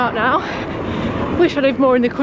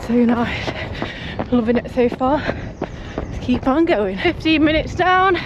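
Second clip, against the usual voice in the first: higher, breathier talk mid-run; while jogging; 5 semitones higher than usual. Real, not acted. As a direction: call out progress while running. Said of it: "I've been going for ten minutes".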